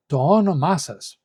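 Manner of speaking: "όνομά" punched on both ό and μά; double stress. Shown as a demonstration. Teacher modelling the written accent for learners, two stresses on "όνομά". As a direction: stress first and last syllables of "όνομά" before "σας". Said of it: "putting the tone of the sas on".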